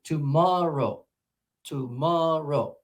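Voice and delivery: slow "tomorrow" swelling on a long middle "mor"; conducted syllable stress. Shown as a demonstration. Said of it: "like you're directing music".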